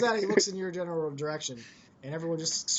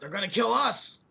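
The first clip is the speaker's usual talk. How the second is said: panicky Bo; 6 semitones higher than usual. Acted.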